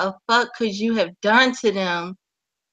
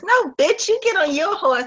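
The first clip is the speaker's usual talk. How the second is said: mock talk back; 8 semitones higher than usual. Talking back to the commander.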